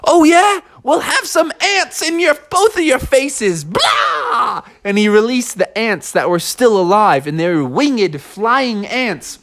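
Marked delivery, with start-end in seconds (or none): 0.0-4.6 high, taunting animal character; feisty anteater. Acted. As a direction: jump into the food fight, ending on a shout. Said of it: "the anteater jumped in".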